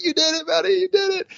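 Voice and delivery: very high, thrilled mock scream of joy; celebrating cheer. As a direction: cheer your son on at the top of your voice, retold. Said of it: "I'm screaming, I'm celebrating".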